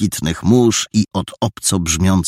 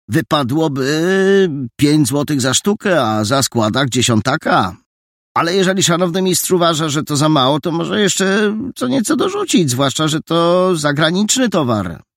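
higher, quick, sly bargaining lad; haggling boy; 9 semitones higher than usual. Acted. Boy haggles with the painter over payment, higher and sly. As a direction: sweet-talk a bigger tip out of him.

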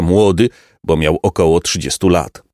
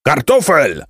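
higher, more forceful roll-call bellow; bellowed name; 7 semitones higher than usual. A narrator's reading. Captain calls for Kartofel who has not answered, bellowing. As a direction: boom out the missing man's name. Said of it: "huknął kapitan".

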